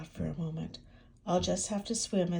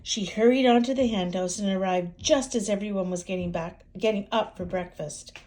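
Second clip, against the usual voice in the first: ordinary delivery, nothing marked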